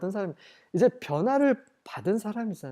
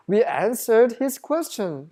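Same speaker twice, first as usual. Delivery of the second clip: slow, measured Bible reading; scripture reading; 5 semitones higher, 5 dB louder, much slower than usual. Acted. Preacher reading the verse to the congregation, slow and measured. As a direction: read each English word out slowly. Reads scripture aloud slowly.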